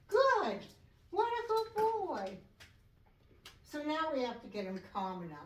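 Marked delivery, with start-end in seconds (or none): 0.1-2.4 high, bright praise to a dog. Real, not acted.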